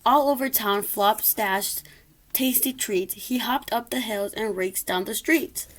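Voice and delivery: ordinary delivery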